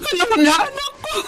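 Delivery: tearful joy. Acted. Mother reunites with returning child.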